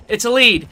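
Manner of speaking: real emphatic self-correction. Real, not acted. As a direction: nail the word lead in frustration. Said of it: "That's what I'm trying to say".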